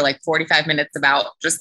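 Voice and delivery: ordinary delivery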